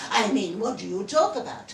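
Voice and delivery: ordinary delivery, nothing marked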